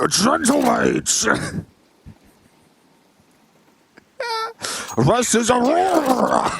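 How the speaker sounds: high, drawn-out mock statesman voice; hammed-up impression, pitched well up; Richard Nixon. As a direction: mimic a pompous presidential address, stretching the words. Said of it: "it just sounds like Richard Nixon".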